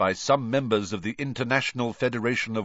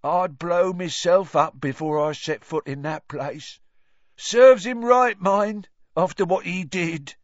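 Ern's voice; 10 semitones higher, 7 dB louder than usual. Acted. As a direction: say it grimly with scorn. Ern on Azkaban.

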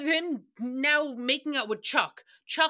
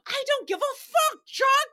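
mock yell; 14 semitones higher, 5 dB louder than usual. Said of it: "it'll never not be funny".

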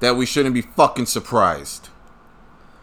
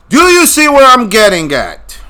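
loud, high exasperated shout; 10 semitones higher, 9 dB louder than usual. Real, not acted. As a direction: yell it at the viewers. Emphatic rhetorical shout.

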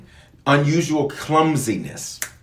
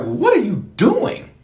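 high, slow scolding of self; disbelieving, drawn-out; mock scolding of own clumsiness; 9 semitones higher, 3 dB louder than usual. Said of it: "and I just like".

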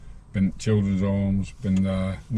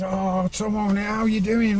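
cheery greeting; 9 semitones higher than usual. Retold or imitated. Tommy greeting him, voiced.

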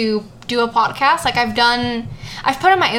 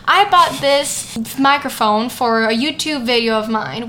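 usual speaking voice

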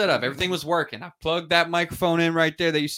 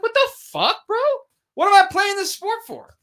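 high, incredulous rant; much higher, louder what-the-hell outburst; outraged; 6 dB louder than usual. Real, not acted. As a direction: scoff in disbelief at the commissioner. Outraged at commissioner's remark.

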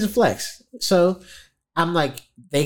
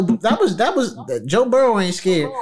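usual speaking voice

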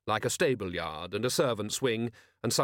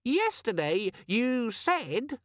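high, slow, squeaky complaint; indignant squeaky demon; 13 semitones higher, much slower than usual. A narrator's reading. Demon accusing Vimes over his threats, indignant squeak. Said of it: "gave him an angry look".

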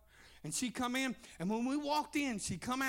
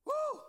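whoop. Real, not acted. Preacher's exclamation mid-reading.

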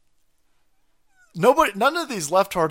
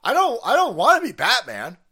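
put-on excited little kid; 3 semitones higher, 5 dB louder than usual. Four-year-old telling Mommy and Daddy, excited kid pleading. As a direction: plead like an earnest small child. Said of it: "I was like".

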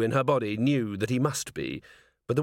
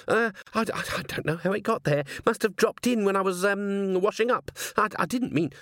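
high, tumbling, embarrassed excuse; flustered stammering; 9 semitones higher than usual. A narrator's reading. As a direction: stumble over the words in a higher, flustered voice. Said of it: "the words tumbled over themselves".

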